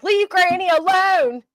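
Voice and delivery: high, slow, put-on voice, leaning on "Leave"; defender voice. Host imagines a viewer defending her, high slow put-on voice. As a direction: play a fierce little protector, high and drawn out. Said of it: "to come up and be like".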